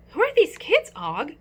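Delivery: a small hamster asking in alarm; a hamster puzzling over strangers; anxious hamster voice. Acted. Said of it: "I asked my neighbor".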